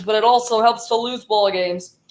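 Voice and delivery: ordinary talk, nothing marked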